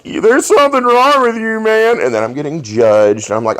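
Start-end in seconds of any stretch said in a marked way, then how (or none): 0.0-2.0 high, mocking voice of a judgy friend; mock judging friend. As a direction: squeak it out like a scandalized buddy. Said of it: "they're like".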